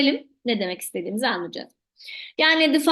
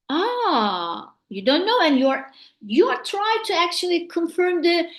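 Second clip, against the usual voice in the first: normal talking voice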